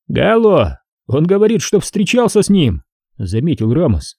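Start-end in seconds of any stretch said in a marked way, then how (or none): 0.1-2.8 high, needling cop voice; mocking detective, high voice; sly cop Ramos. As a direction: poke fun at the suspect, pitched high.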